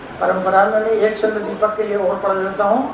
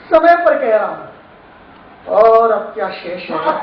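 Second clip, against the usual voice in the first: poem recitation. Acted. Poet urging the audience not to stay silent, rousing recitation. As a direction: declaim it like a poet stirring a crowd.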